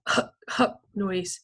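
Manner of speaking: plain reading voice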